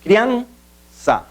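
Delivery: "Criança" split as Crian, ça; "Criança" in two detached pieces, first one stressed; syllable by syllable. Shown as a demonstration. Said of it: "é a que pronunciamos com mais força".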